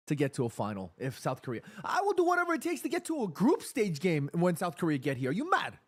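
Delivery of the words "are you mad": ordinary talk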